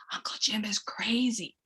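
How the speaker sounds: hushed, playful conspiracy; whispered aside. Shown as a demonstration. Parent to child about Uncle Jim, a joking whisper.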